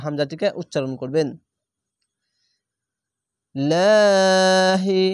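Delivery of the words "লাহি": louder "লাহি" with a long, thin aa; one-alif stretch. Shown as a demonstration. Teacher reciting the example, "লা" stretched long. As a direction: recite "লাহি" very slowly, first vowel held. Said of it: "এক আলিফ টেনে পড়বেন".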